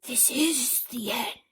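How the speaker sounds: slow, drawn-out despair; doom-laden cry. A narrator's reading. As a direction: announce the end dramatically. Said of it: "cried the old green grasshopper".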